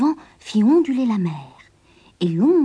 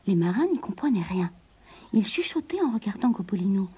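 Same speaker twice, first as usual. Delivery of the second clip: usual speaking voice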